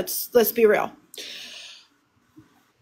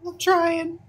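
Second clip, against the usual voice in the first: tearful; 4 semitones higher than usual. Real, not acted. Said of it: "Take a breath".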